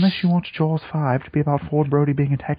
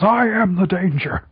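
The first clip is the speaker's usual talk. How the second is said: dramatic raised-voice declaration; mock yell, pitched up and louder; yelling sailboat; 5 semitones higher, 4 dB louder than usual. Sailboat to Ford riding his orca, yelling. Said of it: "the sailboat just yells".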